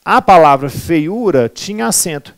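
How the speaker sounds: ordinary delivery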